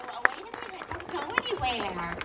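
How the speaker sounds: normal talking voice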